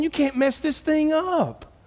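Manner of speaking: preacher voicing Paul, lifted high; emphatic quote. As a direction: proclaim it like a sure promise.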